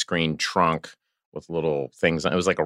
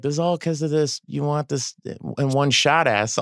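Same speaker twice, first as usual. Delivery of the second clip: lightly acted griping, pitched higher; mock complaint; 7 semitones higher than usual. Colleague blames him for the street closure, mock complaining. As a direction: imitate a colleague's blaming complaint.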